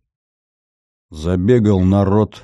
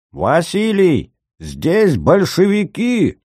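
high, slow strained scream; frantic shouting hostage taker; 10 semitones higher, much slower than usual. A narrator's reading. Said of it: "надрывно закричал Силантий".

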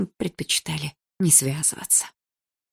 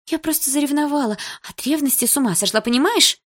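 высоко и взволнованно, почти плача; умоляй, захлёбываясь словами; сбивчиво оправдываясь; 6 semitones higher, 3 dB louder than usual. A narrator's reading. Лика в панике оправдывается. (высокий умоляющий голос Лики)

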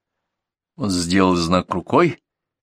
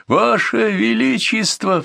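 high, drawn-out cry to the king; slow, high-pitched excited calling; 14 semitones higher, 3 dB louder, slower than usual. A narrator's reading. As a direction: hail the king from horseback. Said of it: "Что-то громко и возбуждённо крича".

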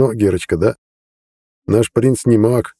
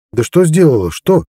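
desperate; 7 semitones higher than usual. A narrator's reading. Harald begging the others to explain, desperate. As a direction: cry out in frustration. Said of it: "с отчаянным в голосе".

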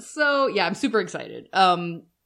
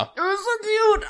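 high-pitched real squeal of adoration; gushing; 11 semitones higher than usual. Real, not acted. Co-host reacting to adorable child singer, gushing. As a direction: melt over cuteness, pitch shooting up.

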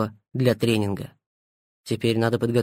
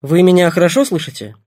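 raised, through headphones; a doctor's voice over the earpiece; 5 semitones higher, 7 dB louder than usual. A narrator's reading. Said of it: "негромкий голос Семёна".

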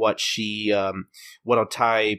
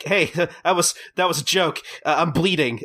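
higher, halting plea with a chuckle; mock hurt protest; 6 semitones higher than usual. Customer elbowed in the face, mock-hurt protest. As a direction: imitate backpedalling after a blow.

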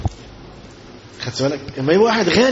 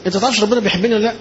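higher and fast, a fretful worshipper asking; mock anxious plea, quick and high; anxious rushed question; 6 semitones higher, much faster than usual. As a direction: rattle it off like a worried believer. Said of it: "في ناس تقول لك كده".

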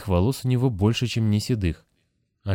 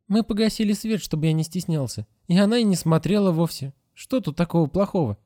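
put-on much higher child voice; innocent child; 10 semitones higher than usual. A narrator's reading. Grasshopper tells the Moose about the light, high and earnest. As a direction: explain earnestly like a small boy. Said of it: "Grasshopper explains".

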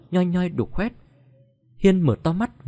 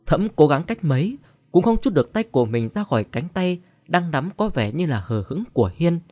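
usual speaking voice

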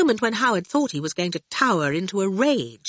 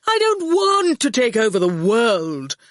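a touch of irritation, higher and louder; irritably; 4 semitones higher, 5 dB louder than usual. A narrator's reading. Torquil snapping back at Howard's accusation, mildly irritable. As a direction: brush the question off, mildly annoyed. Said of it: "Torquil said irritably".

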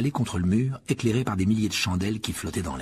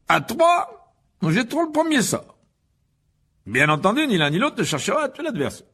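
loud, high, theatrical host; pompous showman teacher; 12 semitones higher, 7 dB louder than usual. A narrator's reading. Lockhart presents his duelling club to the pupils, pompous and projected. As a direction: play the vain professor addressing a crowd.

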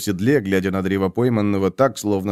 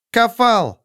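high call of a name; 13 semitones higher than usual. A narrator's reading. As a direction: call his name sharply. Witch calls out shaman.

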